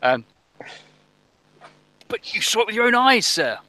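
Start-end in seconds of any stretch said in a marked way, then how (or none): 2.1-3.6 high, insistent sailor voice; protesting crewman. Acted. Crewman insisting to sir about the ship, high protest. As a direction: plead in a voice pitched far up.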